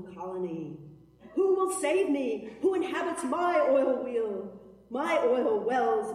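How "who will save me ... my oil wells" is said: loud plea. Acted.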